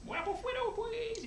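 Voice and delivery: very high squeaky character voice; squeaky cartoon voice.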